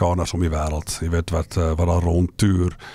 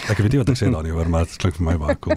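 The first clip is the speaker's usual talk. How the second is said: ordinary talk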